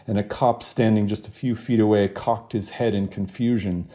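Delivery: ordinary talk, nothing marked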